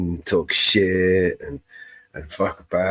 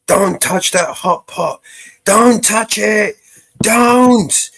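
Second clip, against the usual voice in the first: rising, slow, louder stern parent warning, higher on each don't; a parent pleading then commanding, slowing down; warning a child; 13 semitones higher, 6 dB louder, much slower than usual. Retold or imitated. Telling a kid not to touch the hot pot, slow rising stern warning.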